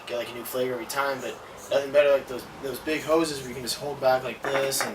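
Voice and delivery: ordinary delivery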